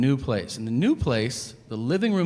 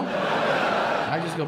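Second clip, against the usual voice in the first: ordinary talk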